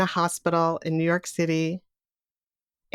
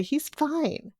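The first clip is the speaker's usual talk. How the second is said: high-pitched protest that all is well; retold parents insisting, higher voice; defensive reassurance; 4 semitones higher than usual. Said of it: "we defend our newborn baby Ben".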